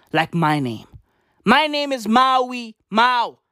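normal talking voice

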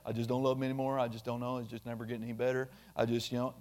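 a hint of a weary spouse giving up; mock despair. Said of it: "Don't say".

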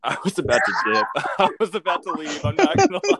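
laughing through the words, rising high; can't stop laughing while talking. Real, not acted. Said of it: "laughs while saying".

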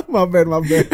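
laughing apology. Real, not acted. (minta maaf sambil tertawa)

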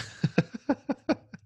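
high, soft laughter; amused laugh. Real, not acted. Host laughs at guest's very true point, amused. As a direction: let a real high laugh slip out. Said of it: "I'm just laughing".

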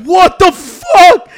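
loud, high, long-drawn what-the-fuck; shocked exclamation. Retold or imitated. Man retelling finding a girl in the bathroom, shocked. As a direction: blurt it out in drawn-out disbelief.

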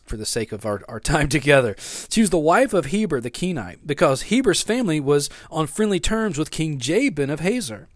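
ordinary talk

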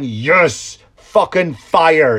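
loud, slow cheer; retold delight. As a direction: relish each word loudly.

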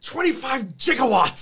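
a hint of Doc Brown's wonder; Doc Brown quote. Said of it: "you looked like fucking Doc Brown".

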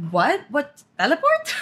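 baffled, rising questions; hammed-up bewilderment breaking into a laugh; confused. Retelling aunt's confusion at seeing grandma twice, confused. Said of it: "she was so confused".

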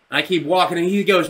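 usual speaking voice

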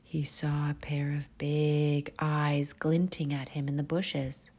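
ordinary delivery, nothing marked

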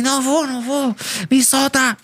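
high, pleading refusal; high mock plea not to go to the clinic; mock protest. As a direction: beg to be let go like a wasted kid. Imitating himself resisting the medics.

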